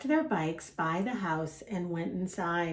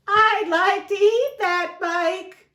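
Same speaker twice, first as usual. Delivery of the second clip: high, slow, louder bleating voice; hungry goat; 12 semitones higher, 8 dB louder, slower than usual. Acted. Goat eyeing Duck's bike, slow bleating. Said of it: "we have to use the voices".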